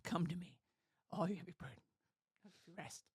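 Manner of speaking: very soft, hushed reassurance; gentle invitation. Retold or imitated. Retelling words of comfort to the burdened, gentle. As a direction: fade almost to a murmur, tenderly.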